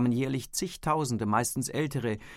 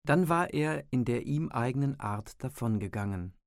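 ordinary delivery, nothing marked

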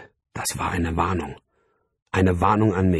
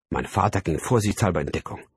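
plain reading voice

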